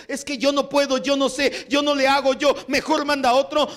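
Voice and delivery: high, pleading excuse-maker; high, whining voice full of excuses; whiny excuses. Retold or imitated. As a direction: mimic a reluctant believer begging off. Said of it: "si tú pones un millón de trabas".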